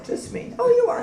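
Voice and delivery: ordinary talk, nothing marked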